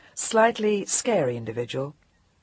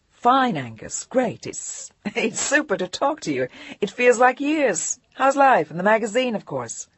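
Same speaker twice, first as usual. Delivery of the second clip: bright phone chatter; 6 semitones higher than usual. Acted. Jillian putting on cheer for her editor. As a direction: put on a cheery show for the boss.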